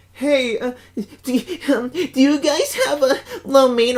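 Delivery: high, hesitant mock prank caller. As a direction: play the nervous kid on the line, voiced high. Prank caller voiced in story.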